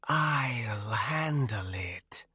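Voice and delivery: slow, unvoiced hissing whisper; hissed whisper. A narrator's reading. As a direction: hush him like a wraith. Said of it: "Nanaya hissed".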